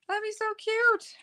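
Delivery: very high, slow and gushing; delighted. Real, not acted. Crafter picturing an owl card, high gushing coo.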